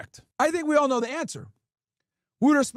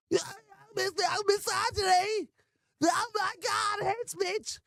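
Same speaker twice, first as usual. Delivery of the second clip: higher, softer sneering voice mocking an offended critic; mock whiny critic; 6 semitones higher, 5 dB quieter, slower than usual. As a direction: whine it like a parody of the other side.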